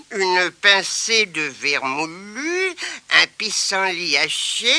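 put-on slow, pondering old woman; old granny. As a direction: count ingredients slowly. Grandmother reciting recipe.

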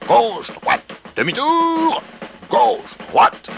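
high, loud marching calls; shouted drill commands. A narrator's reading. Rooster drills the hens marching behind him, shouting. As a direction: call out the steps like a drill sergeant. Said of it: "criait-il".

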